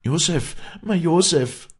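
high, loud cry of a name; anguished cry. A narrator's reading. Girl bursting in to her Josef, anguished cry. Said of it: "mit dem Schrei".

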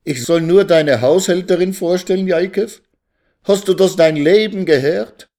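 high-pitched, indignant lament; wailing. A narrator's reading. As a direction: wail in disbelief to your husband. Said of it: "jammerte Resl".

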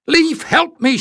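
high, panicked cry for help; frightened cry. A narrator's reading. Jasmine cries to Leif as Cree falls, frightened. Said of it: "she cried".

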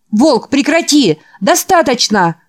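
high, loud, urgent plea; desperate shout. Acted. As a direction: shout him off, pleading. Boy begs wolf to stop.

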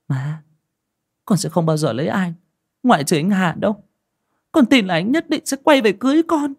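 put-on a stubborn girl defending her fiancé; young daughter voice. Daughter refusing her mother's advice, high, pleading young voice.

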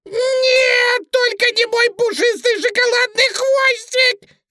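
very high, loud bunny wail; piercing squealed plea; desperate pleading. A narrator's reading. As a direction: beg in full panic as the rabbit. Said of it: "взмолился кролик".

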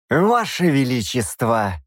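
slow, outraged appeal; protest, scandalized; indignant protest. A narrator's reading. Bishop indignantly turns to the king who let the demon go. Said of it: "возмущённо обернулся к нему епископ".

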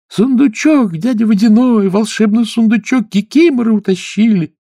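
high, loud pleading chorus; chorus of mermaids. Acted. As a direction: plead all together, high. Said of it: "хором ответили русалки". (хором, высоко)

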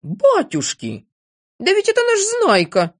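amazed outcry leaping high; high, thrilled exclamation; astonished cry. A narrator's reading. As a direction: cry out in joyful recognition. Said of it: "закричал доктор Пилюлькин".

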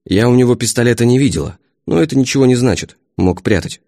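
usual speaking voice

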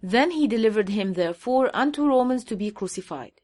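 very slow, word-by-word English Bible reading; slow scripture reading. Shown as a demonstration. Reading the English verse aloud to his audience, very slow and deliberate. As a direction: lay out each word of the verse slowly.

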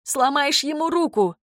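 high-pitched woman's outcry; lightly voiced shouting woman; alarmed exclamation. A narrator's reading. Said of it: "воскликнула она".

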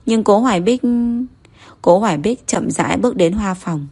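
usual speaking voice

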